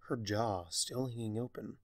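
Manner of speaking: usual speaking voice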